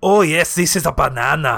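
rough attempt at a Scottish accent; quick demo of a Scottish accent; half-sure Scottish accent. Shown as a demonstration. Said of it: "give me a Scottish accent real quick".

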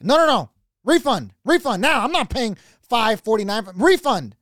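high, loud refund demand; hammed-up insistent protest; demanding customer. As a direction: imitate an outraged shopper refusing to pay. Acts out lady demanding a refund.

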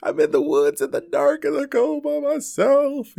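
high, panicked retelling of his own fear; freaking out. Retold or imitated. Alone in the woods at night, freaking out in a high panic. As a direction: squeal it like you're alone and scared. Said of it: "freaking out".

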